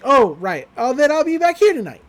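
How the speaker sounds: put-on high, loud, eager girl voice; Mabel's character voice. Girl promising the merman she'll return, high eager voice.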